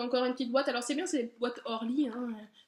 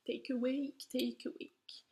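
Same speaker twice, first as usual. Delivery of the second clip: quiet whispered rehearsal of Take Awake; whispered practice; 8 dB quieter, slower than usual. Shown as a demonstration. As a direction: whisper the English name Take Awake to yourself, testing the sounds. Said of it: "désolée encore pour l'accent".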